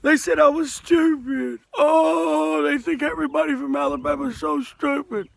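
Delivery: mock the crybabies with a fake wail; exaggerated blubbering complaint; mock crying. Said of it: "You don't see me crying".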